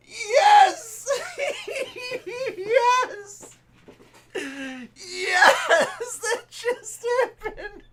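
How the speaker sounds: very high, very loud yells through laughing tears; screaming with joy. Real, not acted. Streamer screaming in triumph over the pulled card. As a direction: scream in real triumph, laughing as you cry.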